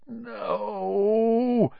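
high, drawn-out wail; anguished moan. A narrator's reading. Sirius crouched on all fours, moaning. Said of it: "he moaned".

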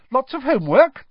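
a keen pupil bouncing up; brightly. A narrator's reading. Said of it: "said Hermione brightly".

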